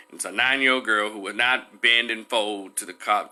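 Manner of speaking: ordinary talk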